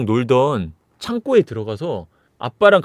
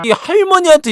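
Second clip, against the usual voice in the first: sharp, high, loud rebuke; angry scolding; 14 semitones higher, 5 dB louder than usual. Retold or imitated. Grandma scolding the zombie grandchild, high angry shout. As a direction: scold the brat who dared attack. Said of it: "이러면서 효자손으로 탁".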